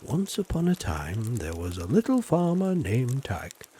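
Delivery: storybook opening in a farmer accent; story time voice. Shown as a demonstration. Farmer shows viewers his story time reading voice. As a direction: read it like the start of a bedtime story. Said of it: "in my story time voice".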